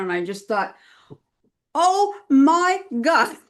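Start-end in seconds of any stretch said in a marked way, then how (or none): 1.7-3.4 drawn-out, loud, high disbelief; retold shock. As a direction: stretch each word out loud and high, aghast. Retold shocked reaction to war email.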